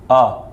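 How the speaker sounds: short clipped अ, said quickly; short a vowel. Shown as a demonstration. Teacher modelling a short vowel for students, quick and crisp. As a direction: give अ a brief, short sound. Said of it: "थोड़ी देर के लिए पढ़ेंगे".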